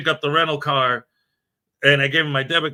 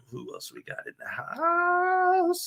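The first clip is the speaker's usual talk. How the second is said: hype host voice; 13 semitones higher, 9 dB quieter, slower than usual. Acted. Calling out to the stream chat.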